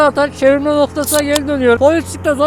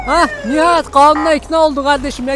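ordinary delivery

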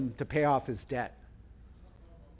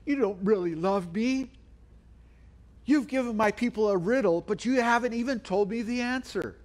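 high-pitched, hammed-up tearful complaint; retold sobbing plea, pitched up; mock sobbing; 7 semitones higher than usual. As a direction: imitate a bride wailing her accusations.

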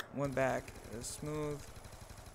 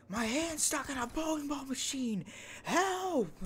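high, panicked mock scream for help; 12 semitones higher, slower than usual. Kid trapped in bowling machine yells for help, high panicked scream. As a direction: shriek it like your hand is trapped. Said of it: "I just remember sitting there screaming".

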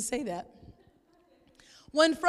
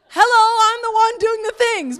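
louder, higher hey-look-at-me protest; mock exasperated call; 7 semitones higher, 8 dB louder than usual. Wife protesting to her husband, loud and high. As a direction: call out like the one stuck doing it all.

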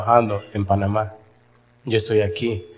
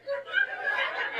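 breathy high giggle; airy laugh; 14 dB quieter than usual. Real, not acted. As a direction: let a soft unvoiced laugh out. Laughing at the story. (risa suave y aireada)

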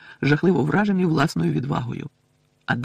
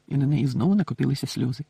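usual speaking voice

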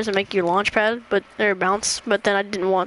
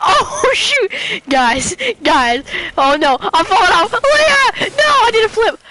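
high panicked screams, laughing between; screaming while falling; 10 semitones higher, 6 dB louder, slower than usual. Real, not acted. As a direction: shriek as you tumble off the edge. Sliding off the map, falling.

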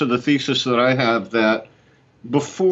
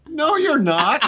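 quoting friend; 13 semitones higher, slower than usual. Retold or imitated. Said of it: "And he goes".

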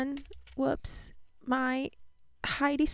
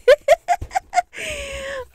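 tearful laugh; 12 semitones higher, 4 dB louder than usual. Real, not acted. Reacting to the packaging.

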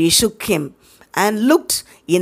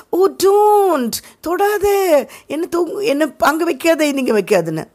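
fake moaning; 8 semitones higher than usual. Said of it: "But now he is acting".

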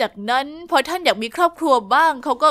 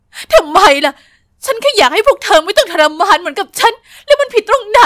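loud, higher anguished shout; mother shouts; 5 semitones higher, 7 dB louder than usual. Acted. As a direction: scream it in despair. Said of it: "แม่ก็ตะโกน". (ตะโกนเสียงดังอย่างสิ้นหวัง)

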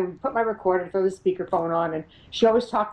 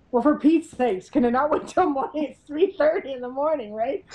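higher-pitched, mildly exasperated retort; 6 semitones higher than usual. Retold or imitated. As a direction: protest at being woken up. Woken at night, exasperated reply.

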